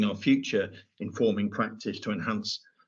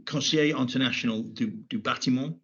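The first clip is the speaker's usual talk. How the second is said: saying the French name in a French accent; a quick stab at a French accent; 3 semitones higher than usual. Real, not acted. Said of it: "Forgive my terrible French accent".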